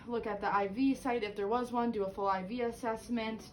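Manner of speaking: usual speaking voice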